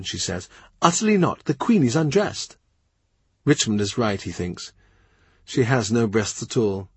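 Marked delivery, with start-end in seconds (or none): 0.8-2.6 put-on lightly higher girlish voice, firm refusal; a prim young girl guarding the door; a touch higher, primly firm; young lady-in-waiting. Mary Howard barring the door.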